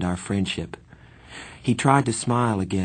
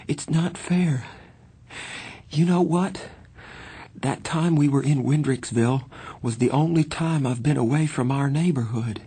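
put-on high, plaintive boy's voice; quivering; 7 semitones higher than usual. A narrator's reading. Johnny telling Pony he doesn't want to die, plaintive. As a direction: plead against dying, pitched well up. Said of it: "He drew a quivering breath".

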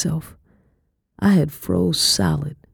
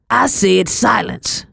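slow, high, forceful command; yelling; 6 semitones higher, slower than usual. A narrator's reading. Master silencing the girl, yelling. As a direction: order silence in a raised, drawn-out yell. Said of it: "Mister Robert yelled".

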